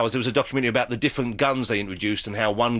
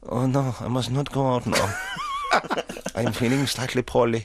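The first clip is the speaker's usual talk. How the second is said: Hitler in a hammed-up German accent; lightly put-on German accent, whiny; mock German accent. Host mocks Hitler for guest in hammed-up German accent. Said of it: "I can do the accents".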